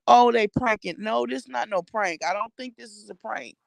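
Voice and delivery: ordinary delivery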